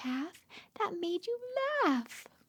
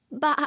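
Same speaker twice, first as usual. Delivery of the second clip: short louder baa; playful sheep bleat; 4 semitones lower, 7 dB louder than usual. Retold or imitated. Mother bleats like a sheep for her baby.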